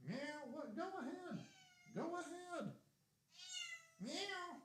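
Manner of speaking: very high sing-song talk to the cat; pet voice. Real, not acted. Talking to the cat.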